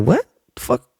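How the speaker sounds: high, fast indignant comeback; mock outraged retort. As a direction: fire back at the big guy, faking outrage. Retells confronting Boogie courtside.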